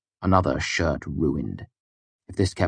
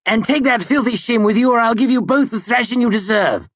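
furious shouting; 6 dB louder than usual. A narrator's reading. Ambrose still shouting.

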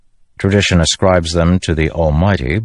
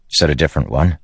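usual speaking voice

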